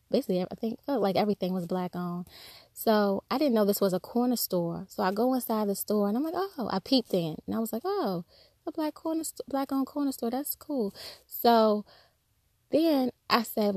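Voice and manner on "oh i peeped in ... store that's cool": high, soft and airy delighted discovery; a soft, lifted "oh, how nice" to yourself; softly delighted, peeking into a shop; pleasantly surprised. Retold or imitated. Pleasantly surprised at corner store.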